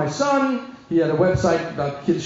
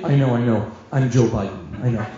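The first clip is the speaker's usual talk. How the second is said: low, deadpan impersonation; deep-voiced, weary reply; Joe Biden; 9 semitones lower than usual. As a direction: drop deep and answer unimpressed. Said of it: "that guy said to me".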